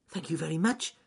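high, pleased little voice; rabbit character, grateful. Acted. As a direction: thank a friend in a high pitch.

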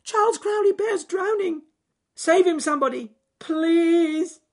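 high, loud pleading call; desperate shout. Acted. Rabbit pleading for someone to save the bear, loud. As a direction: beg for help at full voice. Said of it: "shouted Ernest Fluffy Rabbit".

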